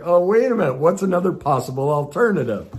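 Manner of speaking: high, loud catch-yourself oh wait; sudden realization. Retold or imitated. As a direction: stop yourself mid-rant, high and bright. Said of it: "and I'm like".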